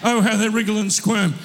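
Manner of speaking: hammed-up self-pitying whine, laughing through it; a childhood rhyme whined in mock misery. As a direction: play the sulky kid, half-laughing. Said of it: "we used to say that as kids".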